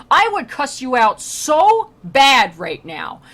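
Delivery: hammed-up angry warning; mock threat. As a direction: lean in and threaten him slowly. Dante threatening to curse pope.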